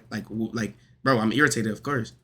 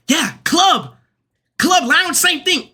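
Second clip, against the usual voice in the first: much higher, mocking nonchalant voice; squeaky sing-song mimic of the friends; 14 semitones higher, much slower than usual. Boyfriend mimicking her friends answering club, much higher and mocking.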